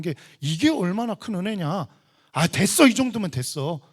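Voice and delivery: high, emphatic imitation of excuses; mock complacency. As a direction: argue loudly that this is plenty. Acting out the complacent believer's excuse.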